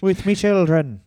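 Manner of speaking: put-on light comic Irish accent; mock Irish accent. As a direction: echo it in a hammy Irish voice. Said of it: "arrived Irish".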